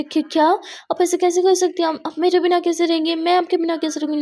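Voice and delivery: put-on upset girlfriend begging her not to leave; distressed pleading. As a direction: plead in alarm, voice climbing. Muskan upset, Aadiya leaving.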